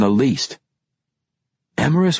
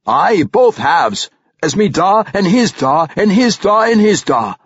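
high, loud, hearty dwarf voice; proud dwarf father; 10 semitones higher, 5 dB louder than usual. Acted. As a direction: count off the forefathers with gusto.

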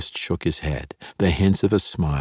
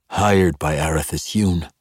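plain reading voice

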